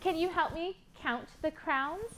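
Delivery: usual speaking voice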